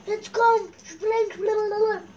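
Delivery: high, loud urging; excited urging. Real, not acted. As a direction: egg the jump on loudly. Urging character in game. (laut anfeuernd)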